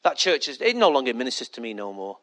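a muttered gripe, lightly voiced; a hint of a disgruntled member moaning about church; mock complaining churchgoer. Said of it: "And that's when people say".

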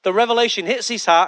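usual speaking voice

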